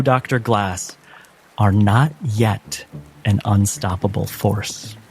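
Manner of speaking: normal talking voice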